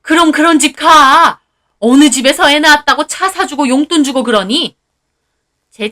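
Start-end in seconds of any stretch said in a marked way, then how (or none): 0.0-4.8 furious mother-in-law. Acted. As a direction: scold her in a rage. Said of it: "어머님은 또 격노하시더라고요". (격노한 시어머니의 호통)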